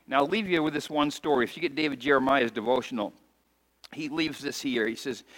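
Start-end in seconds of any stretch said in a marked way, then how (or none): none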